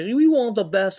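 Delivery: loud, high mock boast; hammed-up high taunt; mocking impression. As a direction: shout it as a parody promo. Mocking the tag team's promo.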